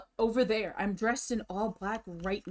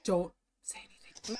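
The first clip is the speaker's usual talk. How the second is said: whispering. Real, not acted. Hushing chat during clip.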